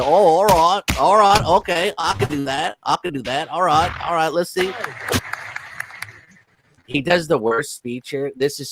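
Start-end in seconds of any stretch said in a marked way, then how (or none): 0.0-4.7 eager mock prince. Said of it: "And I said".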